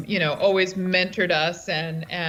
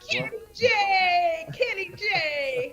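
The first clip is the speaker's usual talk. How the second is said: excited; 10 semitones higher, slower than usual. Real, not acted. Excitedly greeting the newcomer.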